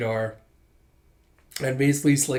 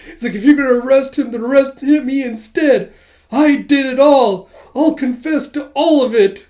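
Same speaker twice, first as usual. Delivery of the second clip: higher, louder, hammed-up tearful speech; imitating a melodramatic weepy plea, much higher and louder; over-dramatized sobbing plea; 10 semitones higher, 9 dB louder than usual. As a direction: ham up a tearful confession. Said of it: "[name] doing the over-dramatized acting".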